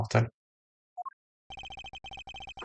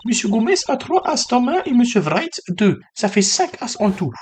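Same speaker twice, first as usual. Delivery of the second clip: much higher put-on voice; high-pitched character voice; 12 semitones higher, 3 dB louder, slower than usual. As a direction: play the card dealer up in a high register. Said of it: "la voix aiguë".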